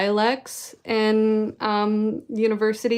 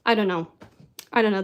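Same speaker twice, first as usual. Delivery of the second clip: usual speaking voice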